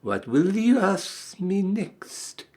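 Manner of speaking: slow, high, mocking scorn; scornful. A narrator's reading. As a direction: drip with contempt. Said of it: "in a voice of dreadful scorn".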